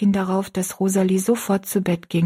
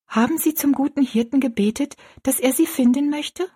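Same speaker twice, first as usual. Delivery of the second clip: put-on high, questioning girl's voice; earnest young girl; 7 semitones higher than usual. Girl asking the woman about her prayer, earnest. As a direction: ask earnestly, pitched up high. Rosalie's character voice.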